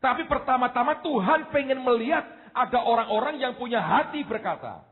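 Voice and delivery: high, intense preaching voice; impassioned sermon delivery. Acted. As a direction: preach it with fervour, rising. Preaching at full force.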